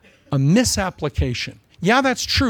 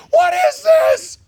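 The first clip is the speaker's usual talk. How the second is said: shocked Paul; 8 dB louder, slower than usual. Acted. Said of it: "Paul would go".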